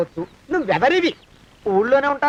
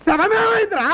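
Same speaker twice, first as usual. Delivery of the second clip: panicked cry; 7 semitones higher than usual. Acted. Master to servant about the missing corpse, panicked shout. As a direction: yell it, pitch climbing in alarm.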